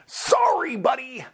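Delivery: high-pitched, drawn-out mock sorry; mock apology. Admiral refusing the scientist's plan, mock-apologetic. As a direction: sing it out high, falsely sympathetic.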